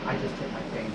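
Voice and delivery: pained mutter. Shown as a demonstration. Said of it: "And I'll say".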